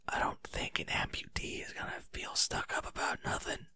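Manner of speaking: a soft unvoiced aside off the script; whispered aside. Real, not acted. Reader whispering a comment on the story text. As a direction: whisper it under your breath.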